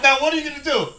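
high, very loud mock challenge; retold shouted demand; screaming instructor. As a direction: yell it as an exasperated instructor. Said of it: "the instructor's screaming at me about kicking".

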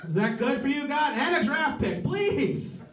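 high-pitched, hammed-up bargaining plea; pleading with God. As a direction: call up to God, haggling playfully.

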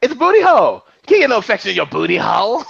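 high, loud comic outburst; hammed-up, high-pitched sassy delivery; Tiffany Haddish impression. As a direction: holler it in an exaggerated comic character voice. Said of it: "Tiffany Haddish".

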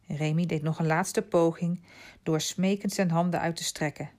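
ordinary delivery

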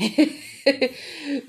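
a quick embarrassed chuckle; short laugh. Real, not acted. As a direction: laugh it off lightly. Laughs at her own habit.

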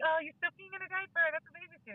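put-on teasing a toddler in a high sing-song voice; mock teasing, high and singsong. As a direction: sing it high like kids ribbing a little sister. Kids teasing toddler about diaper.

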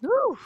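loud, high-pitched "woo"; high whoop. Real, not acted. Reacting to a remark about offending people, loud whoop. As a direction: let out a sudden high whoop.

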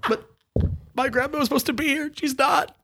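high, soft, put-on innocent plea; mock pleading. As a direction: pretend to beg the tribe to believe. Faking grief to the tribe.

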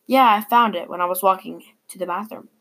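ordinary delivery, nothing marked

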